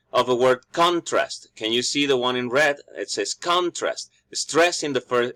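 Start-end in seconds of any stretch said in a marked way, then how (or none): 0.7-1.5 CON-trast, strong falling first syllable; first-syllable stress. Shown as a demonstration. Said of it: "is in the first syllable". 3.3-4.1 CON-trast, strong falling first syllable; first-syllable stress. Shown as a demonstration. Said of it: "is in the first syllable".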